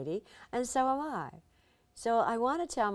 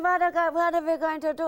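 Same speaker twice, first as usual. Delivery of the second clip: put-on high, loud, panicky Hungarian accent; Eva Gabor alarmed; 11 semitones higher, 10 dB louder than usual. Eva stranded at the bull ring, high and panicky Hungarian. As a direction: gasp it out like a stranded diva. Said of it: "She said".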